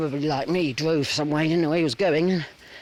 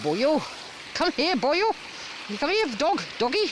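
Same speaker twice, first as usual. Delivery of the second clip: mock Welsh calling; 8 semitones higher than usual. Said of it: "people calling them boyo".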